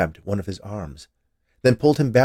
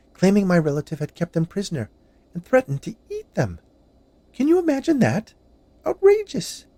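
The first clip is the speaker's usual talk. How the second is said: higher, louder indignant woman; 10 semitones higher, 5 dB louder than usual. A narrator's reading. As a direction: build up to outraged disbelief. Witch tells her grievance.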